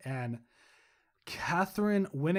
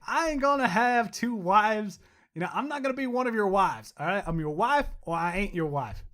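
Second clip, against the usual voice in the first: put-on higher, louder Southern accent; 4 semitones higher, 3 dB louder than usual. A Southern-accented wife refusing to share her husband. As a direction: play her as a Southern woman who won't share. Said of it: "why'd you go in a southern accent like that".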